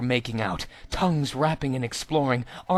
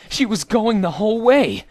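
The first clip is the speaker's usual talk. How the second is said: higher and louder, bursting with glee; excited exclamation; 8 semitones higher, 8 dB louder than usual. A narrator's reading. Narrator cheers Minjo's luck, gleeful higher louder exclamation. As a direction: let the thrill burst out.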